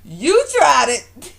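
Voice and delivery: loud, high, drawn-out tease; playful scolding. Real, not acted. Vlogger calling out Walter's nerve, amused scolding. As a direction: call him out slowly and loudly.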